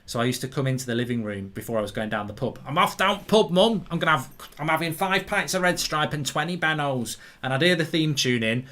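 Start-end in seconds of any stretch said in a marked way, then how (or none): 2.7-7.2 put-on Manchester accent. Teenage self telling mum about the pub, Manchester accent. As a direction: call out like a Manchester lad. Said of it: "used to speak with a Manchester accent".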